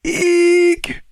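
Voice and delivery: piercing high-pitched "eek"; high squeal. Retold or imitated. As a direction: squeak out a mock-excited little shriek. Reads out listener's excited squeal.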